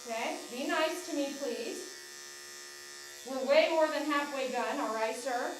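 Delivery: coaxing pet voice. Real, not acted. Groomer soothing the tense dog on the table, high pleading coax. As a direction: plead with the dog to stay calm.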